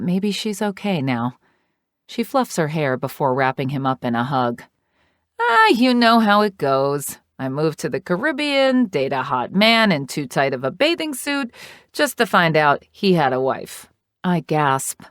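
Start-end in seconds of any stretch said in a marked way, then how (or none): 5.4-13.9 higher, louder, brassy gossiping aunt; a loud, sassy older woman telling her drama; sassy Trixie. Acted. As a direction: dish the tea like a sassy aunt. Trixie's dialogue voiced.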